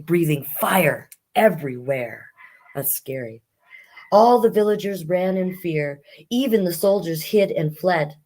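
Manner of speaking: lively rhyming read-aloud; reading a rhyming fairy tale to children; storybook narration. Acted. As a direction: tell the fairy tale to young listeners.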